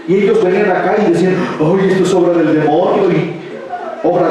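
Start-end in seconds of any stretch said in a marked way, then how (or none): none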